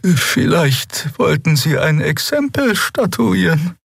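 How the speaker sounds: slow, high, halting character voice; faint, struggling speaker. Acted. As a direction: speak slowly and high, barely keeping upright. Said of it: "schien sich nur mit Mühe auf den Beinen halten zu können".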